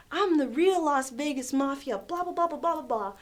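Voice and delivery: retold puffed-up rant trailing into blahs; mock tough boasting. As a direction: bluster like a self-declared mafia boss. Retold customer boasting in a quarrel.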